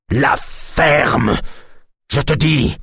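angry outburst. A narrator's reading. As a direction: cut him off hard. Chief shouts down healer again.

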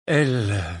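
hesitant start in a hoarse voice. A narrator's reading. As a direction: begin huskily, then stall. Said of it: "la voix rauque". (voix rauque, hésitante)

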